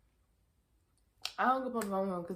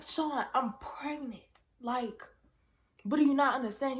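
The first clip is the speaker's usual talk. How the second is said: higher, exasperated repeat; 7 semitones higher, 5 dB louder than usual. Retold or imitated. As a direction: spell it out, fed up.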